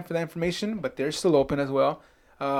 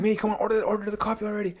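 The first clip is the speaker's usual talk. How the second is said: impatient; 6 semitones higher, 3 dB quieter, faster than usual. Retold or imitated. Impatient self-talk at the counter.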